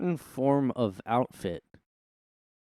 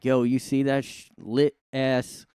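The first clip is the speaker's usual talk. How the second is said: mock teen slang voice. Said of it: "just like".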